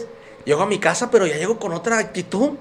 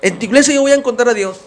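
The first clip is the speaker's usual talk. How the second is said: loud, higher, urgent retold plea; imploring with raised conviction; desperate insistence; 4 semitones higher, 7 dB louder than usual. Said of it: "Yo en mi desesperación".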